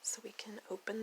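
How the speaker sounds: hushed whisper; soft unvoiced whisper. Real, not acted. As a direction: drop to a whisper as you open them. Whispered aside.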